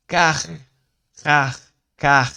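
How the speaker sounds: car with the R rolled in the throat; car, loud, throat trembling on the R; French throat R. Shown as a demonstration. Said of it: "sua garganta está tremendo". (car com R vibrando na garganta)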